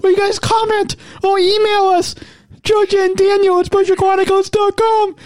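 playful put-on high host voice. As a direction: keep the silly high pitch going. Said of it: "if you like my voice".

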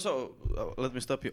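ordinary talk

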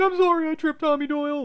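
very high whiny mock sob voice; crying bully. Retelling the bully's apology, high mock whimper. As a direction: whimper it like a beaten kid. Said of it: "he's like".

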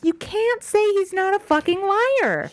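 high, drawn-out, emphatic protest; slow it down and pitch it way up; indignant, word-by-word insistence; exasperated insistence. Real, not acted. Exasperated argument about the lying.

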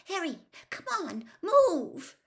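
high, urgent plea, loud on the last word; frightened urging. A narrator's reading. Hermione tugging Harry backwards from the skull, frightened urging. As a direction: urge them away in a high panicky voice.

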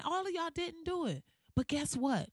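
ordinary delivery

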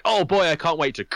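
put-on high, bright eager "Oh boy"; eager excitement. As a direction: sound thrilled to try it on. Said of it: "you're like".

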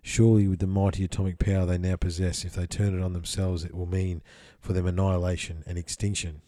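measured, solemn reading voice; steady scripture-style reading; reading a channeled text. Acted. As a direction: read it out like old scripture. Reads channeled text aloud.

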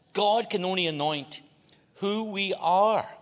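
slow, loud, high stress on key words; emphatic sermon point. Real, not acted. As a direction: hammer the point home.

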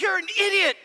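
high, loud fond rebuke; exasperated scolding. Retold or imitated. After the rescue, to his friend Chris, a high exasperated scold. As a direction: tell him off, relieved and fed up. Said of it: "I'm like".

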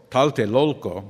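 "Tlatelolco" की सुधरी बोली, आख़िरी हिस्सा "lolco" साफ़ और ज़ोर से; corrected pronunciation. Shown as a demonstration. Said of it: "excuse me, not Loco, but Lolco".

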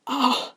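high, loud gasp; startled moan. A narrator's reading. Man overcome by a sudden sensation, gasping aloud.

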